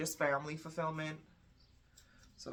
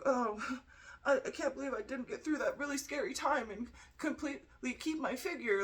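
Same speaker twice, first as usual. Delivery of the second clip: higher, put-on whiny self-pity with a laugh; mock your own vanity; mock vain complaint; 9 semitones higher than usual. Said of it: "instead of being like".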